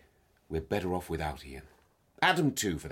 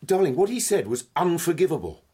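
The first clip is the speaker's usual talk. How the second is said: higher and louder, outraged; indignant; 10 semitones higher, 8 dB louder than usual. Acted. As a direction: burst out in indignation. Husband angry over friend's insult.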